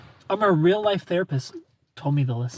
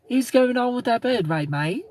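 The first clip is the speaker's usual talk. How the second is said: higher-pitched Australian accent; 7 semitones higher than usual. Shown as a demonstration. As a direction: put on an Aussie accent, voice pitched up. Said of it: "See, that's Australian".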